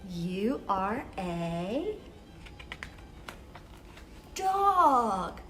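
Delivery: ordinary delivery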